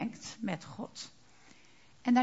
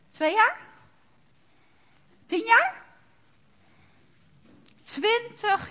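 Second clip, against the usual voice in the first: rising outcry; 9 semitones higher, 4 dB louder, slower than usual. Real, not acted. Preacher builds rhetorical question.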